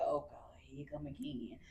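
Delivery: low, whispered weary dread; hushed groan at a rash. Retold or imitated. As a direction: breathe it out with dread under your breath. Inner dread at a new rash.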